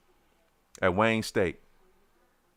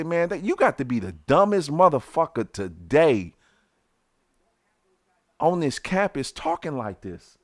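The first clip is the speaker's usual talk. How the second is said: higher, heated insult; retold angry rant; 4 semitones higher than usual. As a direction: tell him off, riled up. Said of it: "I say".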